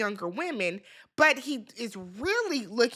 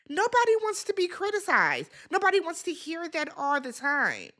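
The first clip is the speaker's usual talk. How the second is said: high-pitched, emphatic telling-off; fed-up, high-voiced reproach; exasperated scolding; 6 semitones higher than usual. Real, not acted. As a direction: scold her in a raised pitch. Scolding Shannon for criticizing.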